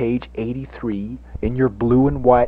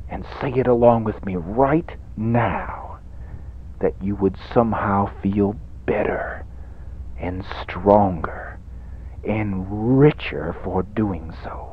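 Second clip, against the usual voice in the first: hushed preacher; 3 semitones lower, 5 dB quieter than usual. Acted. Hushed preacher guiding the flock.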